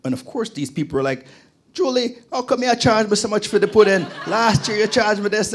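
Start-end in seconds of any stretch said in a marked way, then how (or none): 1.7-5.5 laughing mock complaint, much higher and louder; complaining customer. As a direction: whine at Julie through a laugh. Customers complaining about pudding price.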